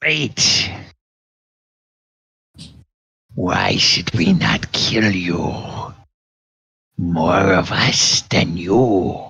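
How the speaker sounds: slow growling demand; gnoll voice. Acted. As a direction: demand gold like a greedy gnoll.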